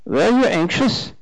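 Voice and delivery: put-on high German-accented question. A narrator's reading. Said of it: "three months over from Germany".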